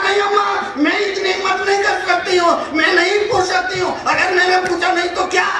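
high, exclaimed retold protest; protesting wife. Wife telling the old woman she dare not ask, protesting.

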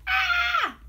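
very high, loud mock scream "Ah!"; women's scream. Women seeing the giant, high mock scream. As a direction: shriek in terror at the giant. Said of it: "Women screamed".

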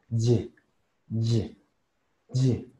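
"Y" as a short "dzhyeh"; fuse D and buzz into "Y"; D, Z, Y joined. Shown as a demonstration. Teacher repeating the symbol's sound, "Y" as "dzhyeh". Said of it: "una Z y una Y".

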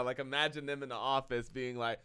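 ordinary talk, nothing marked

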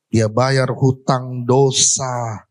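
ordinary delivery

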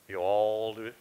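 drawn-out Southern drawl, a little low. Shown as a demonstration. Preacher shows the congregation a Southern dialect, in a drawl. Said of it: "southern dialect is kind of a drawl".

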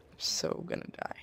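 soft doomed whisper; whispered dread. Real, not acted. Gamer expecting to die, whispered dread. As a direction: whisper it as if already lost.

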